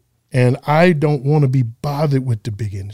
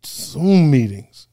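slow, drawn-out disdain; weary, contemptuous groan of an answer; much slower than usual. Real, not acted. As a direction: drag the words out with distaste. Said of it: "You said that with such disdain".

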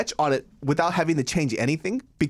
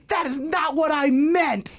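defensive high protest; 13 semitones higher, slower than usual. Retold or imitated. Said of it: "And she goes".

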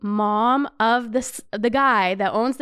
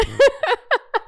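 high giggling burst; real laugh; 12 semitones higher, 4 dB louder than usual. Real, not acted. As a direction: laugh out loud at your own story. Laughs at one minute on yacht.

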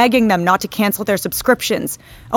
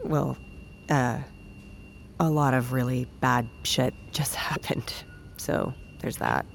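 muttered aside; 8 semitones lower, 13 dB quieter than usual. Acted. Awkward confession.

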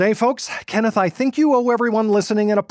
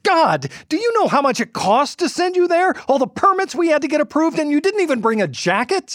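high, incredulous scolding; exasperated; 8 semitones higher than usual. Acted. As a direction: scold him in disbelief. Boss berating reporter.

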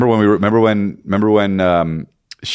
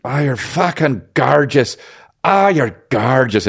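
high, very slow admiring croon; Colin Farrell; 5 semitones higher, much slower than usual. Retold or imitated. Imitating star wooing friend's girlfriend, slow high swoon. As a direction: stretch every word in mock rapture. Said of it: "Colin Farrell was just like".

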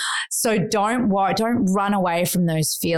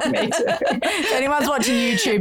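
laughing hard; 7 semitones higher than usual. Real, not acted. Joking with her co-host about viewers, laughing. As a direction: let the laugh carry the words up high. Both laughing after emotional talk.

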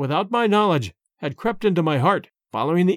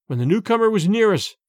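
plain reading voice

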